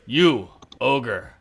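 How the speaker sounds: slow, loud commanding boom. Acted. Guard captain ordering the crowd away, slow booming voice. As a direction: boom out orders to clear the street. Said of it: "you hear this guy's booming voice".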